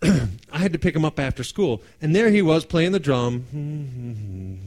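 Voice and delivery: usual speaking voice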